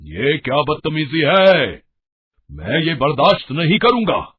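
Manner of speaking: angry shout. Acted. Father-in-law shouts at his wife at the table, furious. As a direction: roar at her for spitting out food. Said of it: "चीखते हैं".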